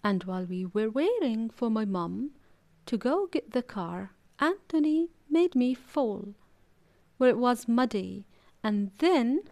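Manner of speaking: ordinary delivery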